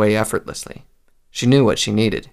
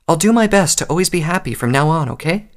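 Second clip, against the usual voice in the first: put-on raised, sweetened promise to a child; coaxing mother; 7 semitones higher than usual. A narrator's reading. Mother on her knees promises her filly, coaxing. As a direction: promise it high, easing off on the final check.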